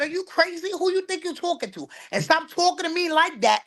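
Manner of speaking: high, indignant mock scolding; an incredulous mother's rebuke; scolding daughter. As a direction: talk down to a grown daughter. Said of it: "I said".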